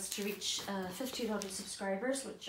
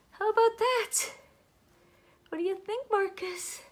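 gushing to doll; 10 semitones higher, 6 dB louder than usual. Real, not acted. Talking to the doll Marcus.

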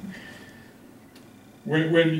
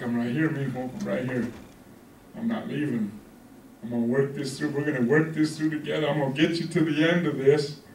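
low, soft, steadfast comfort; quiet voice of a patient father; steady reassurance; 3 semitones lower, 5 dB quieter than usual. Acted. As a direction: promise gently that you will stay. Voices God promising to stay.

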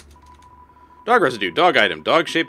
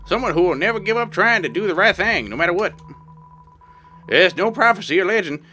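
put-on old prospector; 5 semitones higher than usual. Reads NPC lines in character.

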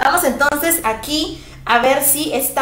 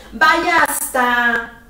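Vaya hasta stretched very slowly; slow, emphatic Spanish model; slow model phrase; 4 dB louder, much slower than usual. Shown as a demonstration. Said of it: "Simplemente te dice".

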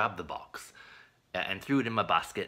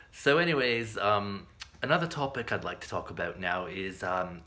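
ordinary talk

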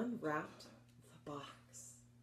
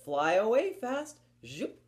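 fast; 4 semitones higher, 6 dB louder than usual. Shown as a demonstration. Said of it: "One named Fast".

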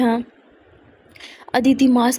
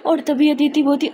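plain reading voice

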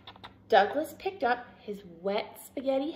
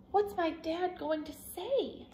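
high, softer fretting; worried; 8 semitones higher, 6 dB quieter than usual. A narrator's reading. Douglas dreading his dad's reaction, soft high worry. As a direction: fret quietly in a high voice. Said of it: "he worried".

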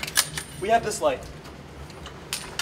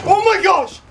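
high loud shriek of fright; frightened high cry; startled scream; 11 semitones higher, 8 dB louder than usual. Real, not acted. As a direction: jump and shriek. Something in the dark.

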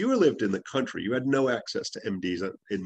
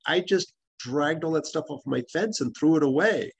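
a lightly voiced daughter, pitched higher; daughter's offhand voice; 6 semitones higher than usual. Patient's daughter telling the doctor she threw the plant away, lightly voiced higher. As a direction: retell the daughter brushing it off. Daughter quoted in clinic story.

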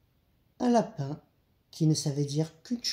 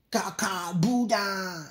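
wolf's reply; 3 semitones higher, much slower than usual. A narrator's reading. Sick wolf answers the doctor.

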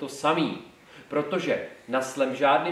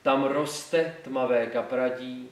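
slow, measured classical poetry reading; solemn school-recital delivery, dropping at line ends; poem recitation; 2 semitones lower than usual. Shown as a demonstration. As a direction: recite it slowly, with pauses between phrases.

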